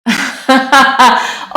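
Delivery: loud burst of laughter; laughing. Real, not acted. Painter amused by own bad pirate accent, laughing out loud.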